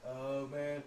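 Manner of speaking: low, soft mumble of a sulking brother. Retold or imitated. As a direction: answer like a sulky man giving up. Brother voiced in retold argument.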